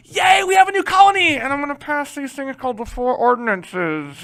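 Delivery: loud, high fake cheer sliding into a sly aside; hammed-up royal cheer, then slip in the scheme; mock cheerful king. Teacher voicing Charles the Tenth hiding his law behind a cheer. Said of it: "Charles the Tenth is gonna be like".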